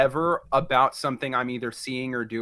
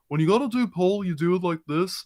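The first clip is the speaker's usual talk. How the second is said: imitated Simon Pegg; 3 semitones higher than usual. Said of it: "he says".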